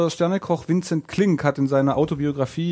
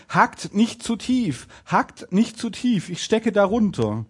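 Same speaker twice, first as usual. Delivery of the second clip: slow, higher-pitched storybook plea; calling out; 6 semitones higher, slower than usual. A narrator's reading. Tiny tailor warning the butchers' choppers, calling out. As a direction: call the warning up from below, drawn out. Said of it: "rief er".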